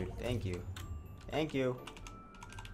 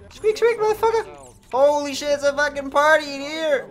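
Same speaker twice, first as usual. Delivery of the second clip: very high, loud squeaky rat cry; 9 dB louder than usual. Acted. Rat squealing his catchphrase at the humans.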